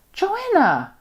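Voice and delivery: a sharp high cry of her name; sudden outcry. Acted. Narrator bursts out at Joanna.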